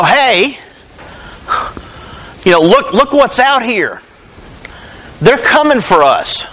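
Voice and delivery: a servant urgently warning the boss; hint of panic, in short bursts; mild mock alarm. Acts the servant warning Elisha.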